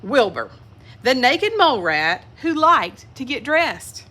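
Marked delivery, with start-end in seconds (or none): none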